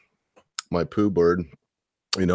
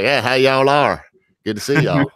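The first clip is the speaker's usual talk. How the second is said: higher, louder, slow hammed-up Texan accent; 7 semitones higher, 6 dB louder than usual. Teasing his friend for sounding Texan, loud hammed-up Texan accent. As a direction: stretch it out like an over-the-top Texan. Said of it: "you must be from, like, Nagadoshi, Texas".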